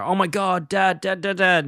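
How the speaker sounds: high, panicky retold cry, not a full scream; mock screaming kids. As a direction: imitate children frantically calling for their father. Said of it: "the kids go screaming to Homer".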